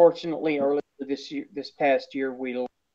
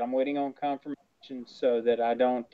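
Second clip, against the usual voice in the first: ordinary delivery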